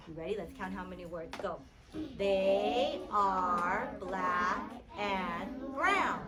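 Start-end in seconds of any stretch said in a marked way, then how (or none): 2.2-6.2 very slow, word by word; slow counting read. Shown as a demonstration. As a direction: stretch each word apart for counting. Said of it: "We're gonna say".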